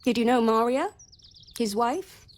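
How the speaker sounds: sudden intense probe; urgent probing question. Acted. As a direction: press her hard with it. Pointed question about the wife.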